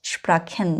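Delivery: স্প্রাখেন said with "kh" for CH; low-key teacher's repeat of স্প্রাখেন with "kh". Shown as a demonstration. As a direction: pronounce CH in স্প্রাখেন like খ. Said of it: "সেজন্য এটা আমি খ উচ্চারণ করেছি".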